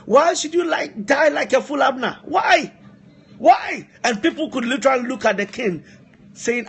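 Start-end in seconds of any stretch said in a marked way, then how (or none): 0.0-3.9 higher, rising to louder anguished cries over a dead friend; acted weeping lament. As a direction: wail the questions like a grieving king, climbing to a cry. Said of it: "He was crying. He said".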